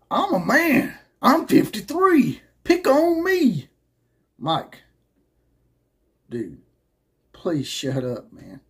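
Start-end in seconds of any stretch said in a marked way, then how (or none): 0.1-3.7 much higher, louder, slow mock shouting; mocking Gundy rant. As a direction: ham up an angry coach's outburst. Mocking the coach's famous rant.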